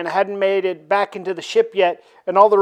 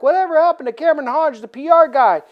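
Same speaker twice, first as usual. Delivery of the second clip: rapid reporter question; 6 semitones higher, faster than usual. Retold or imitated. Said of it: "is like".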